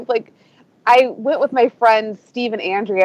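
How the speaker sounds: normal talking voice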